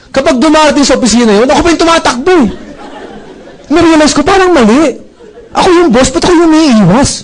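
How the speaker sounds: high, rising mock exasperation; a boss hamming up his own silly retreat; mock exasperated. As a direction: play up the absurdity of the boss hiding. Mock exasperation at running away.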